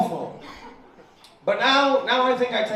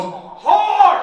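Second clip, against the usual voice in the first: put-on much higher, louder child's yell; proud kid shouting; 14 semitones higher, 9 dB louder than usual. Little girl shouting the word at her teacher, high yell. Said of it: "looks me dead in the eyes and goes".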